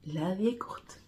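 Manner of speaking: usual speaking voice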